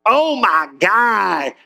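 normal talking voice

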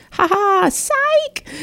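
sky-high singsong gloat; mocking taunt. To doctors who said she'd never walk, a high mocking gloat. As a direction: taunt them like a kid.

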